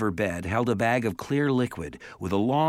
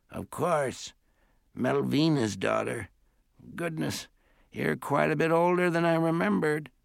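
put-on composed elderly great-aunt; 6 semitones higher than usual. A narrator's reading. Great-aunt recognizes her grown niece, composed old-lady voice. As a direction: play an old aunt, pitched high and measured.

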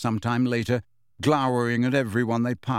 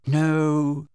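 slightly higher, drawn-out miserable no; 4 semitones higher than usual. A narrator's reading. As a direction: let the word sag with misery. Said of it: "said Ginny miserably".

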